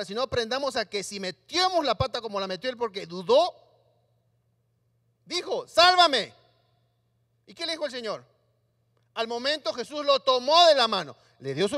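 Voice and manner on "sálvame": urgent loud sálvame; loud plea for rescue. Acted. Pedro who doubted, crying to the Lord to save him, loud. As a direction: shout to be saved.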